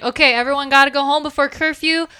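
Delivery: urgent warning. Acted. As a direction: hurry everyone out with a warning. Sheriff warns pub about curfew.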